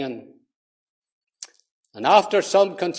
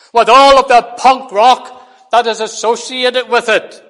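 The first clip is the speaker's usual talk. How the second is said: preaching at full force; 9 semitones higher, 12 dB louder than usual. Acted. Preacher to congregation, condemning the songwriter: full-force preaching. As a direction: thunder it out against the claim. Preacher denounces heavy metal claim.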